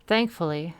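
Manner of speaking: ordinary delivery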